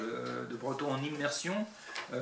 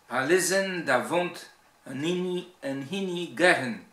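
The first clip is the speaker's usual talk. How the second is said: Breton verse with strong Cornouaille stresses; emphatic Cornouaille Breton recitation; Cornouaille Breton accent; 4 semitones higher, 7 dB louder than usual. Shown as a demonstration. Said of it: "l'accent cornouaillais".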